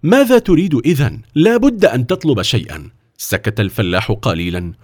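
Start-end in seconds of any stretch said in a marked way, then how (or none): 0.0-2.9 high-pitched, pressing demand; exclaim, insisting on an answer; raised voice, urging someone to choose; insistent exclamation. A narrator's reading. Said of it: "هتف الأب".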